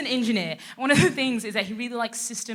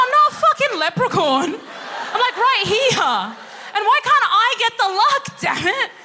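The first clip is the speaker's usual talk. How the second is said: high, loud indignant rant, laughing through; mock outrage; 10 semitones higher, 6 dB louder than usual. Daughter ranting at the Swiss family, high and loud.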